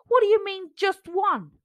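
high, loud disdain; mock the tiny offer; scornful pea. A narrator's reading. Jack scornful.

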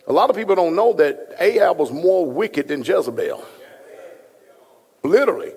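ordinary talk

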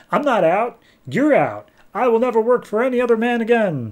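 high-pitched mock defiance; indignant, hammed-up comeback; defiant retort. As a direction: fling it back in an acted quarrel. Etrigan angrily quarrels with Lucifer.

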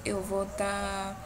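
ordinary delivery

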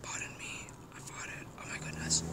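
very soft, furtive whisper; whispered confession. Pretend. Vlogger confessing a fart, hushed mock embarrassment.

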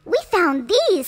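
high, loud squeaky mouse; excited mouse. A narrator's reading. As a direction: burst in proud like a little mouse. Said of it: "said Gus".

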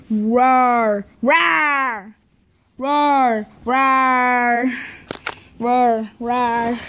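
drawn-out pretend monster roars; playful scary roar. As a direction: roar to scare a friend in play. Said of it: "I will scare you".

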